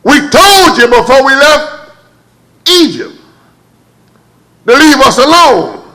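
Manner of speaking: high, loud grumbling crowd; complaining Israelites. Retold or imitated. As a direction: scold Moses as a whole people. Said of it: "They said".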